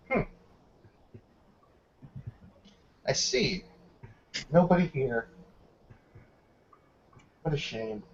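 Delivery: a hint of a quiet, menacing captain; lightly voiced officer, soft and cold; guard captain. The guard captain softly to Dex, lightly menacing.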